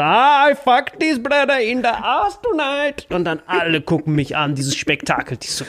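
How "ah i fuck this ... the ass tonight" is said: high, slow put-on voice; gloating worker voice. Bangladeshi worker gloating at the storyteller, slow high put-on voice. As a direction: drag it out like a gloating troll. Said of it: "Und der so".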